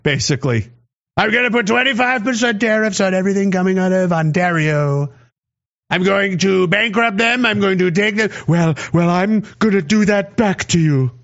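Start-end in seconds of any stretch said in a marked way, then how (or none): 1.2-11.1 lightly put-on politician voice; mock speechmaking, slightly off the usual voice; Trudeau. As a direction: imitate a leader announcing retaliation. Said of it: "Trudeau was like".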